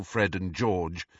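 plain reading voice

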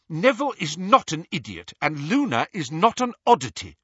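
high, loud protest; indignant defence. A narrator's reading. Hermione defending friends to the portrait, indignant. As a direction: stand up hotly for insulted friends.

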